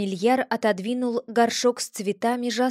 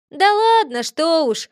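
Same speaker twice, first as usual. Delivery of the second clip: high, louder brushing it off; dismissive wave-off; 6 semitones higher, 6 dB louder than usual. A narrator's reading. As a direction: shrug it off breezily. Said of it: "махнул рукой Леший".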